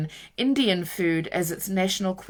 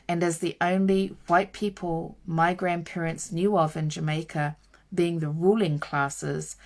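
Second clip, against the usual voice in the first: ordinary talk, nothing marked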